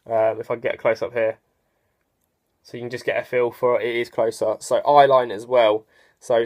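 talking behind face cage; speaking in football helmet. Real, not acted. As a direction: helmet padding around cheeks. Seen 0: Helmet with face cage worn from first frame after -1.0; not in R frames.

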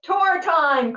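high, loud announcing cry; loud, high and eager; excited cry. Acted. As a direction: call the class to the tour. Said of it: "cried Mister Budget".

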